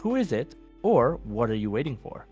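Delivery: normal talking voice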